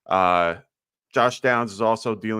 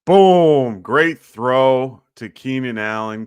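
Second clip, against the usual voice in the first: excited; 5 semitones higher, 9 dB louder, much slower than usual. Real, not acted. Touchdown called live on stream.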